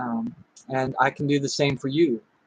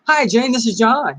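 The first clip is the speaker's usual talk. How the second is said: higher, louder, lively phone greeting; energetic standing-up delivery; 6 semitones higher, 4 dB louder than usual. Shown as a demonstration. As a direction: say it like you're on your feet and moving. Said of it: "that helps put emotion into your voice".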